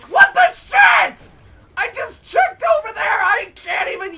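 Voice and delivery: squealed what the shit; shrieking outrage. Real, not acted. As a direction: scream it in disbelief. Outraged at the game.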